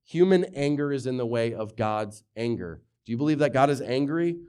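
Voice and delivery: usual speaking voice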